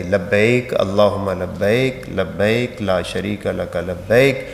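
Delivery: slow, measured Arabic recitation; correct talbiyah recitation. Shown as a demonstration. Teacher modelling the talbiyah for pilgrims, slow careful recitation. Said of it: "تاکہ آپ کی زبان کے اوپر یہ صحیح انداز سے آ جائے".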